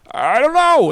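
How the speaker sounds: very high, loud mock wail; hammed-up helpless protest; exasperated neighbor. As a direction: cry it out as the flustered neighbor. Imitates neighbor giving in.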